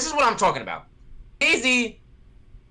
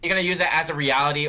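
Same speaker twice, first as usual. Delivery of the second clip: normal talking voice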